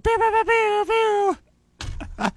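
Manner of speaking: high, slow zapping pew noises; laser pew sounds. Acted. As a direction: fire off laser pews. Laser sound effect intro.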